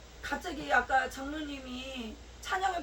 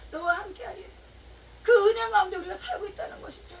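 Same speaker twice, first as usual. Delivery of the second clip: fervent, raised sermon voice; preaching; 4 semitones higher than usual. Real, not acted. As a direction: preach it to the congregation with conviction. Preaching to the congregation.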